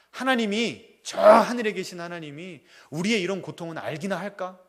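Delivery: a grieving son grumbling loudly at God. Retold or imitated. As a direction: grumble at God, half accusing. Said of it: "툴툴대면서 읽었답니다".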